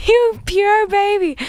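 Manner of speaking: put-on slow high cooing, a touch of baby talk. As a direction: coo it like talking to a baby. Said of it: "I was like".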